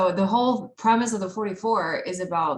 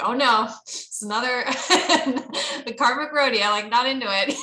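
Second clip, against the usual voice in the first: high, giggly mock panic; half-laughing, playfully dramatic groan; laughing mock dread; 5 semitones higher, 4 dB louder than usual. Recalling dating fears to the group, laughing mock dread. Retelling her dread over repeated 44s.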